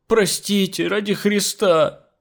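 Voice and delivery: high whiny plea; whining. A narrator's reading. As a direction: whine for forgiveness, dragging the last words. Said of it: "ныла Нюша". (жалобное нытьё)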